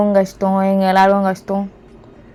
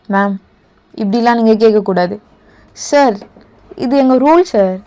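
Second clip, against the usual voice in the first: raised voice of an officer insisting on the rule; heated officer argument; 6 dB louder than usual. Acted. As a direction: push back hard, an official losing patience. Officer arguing rules with woman.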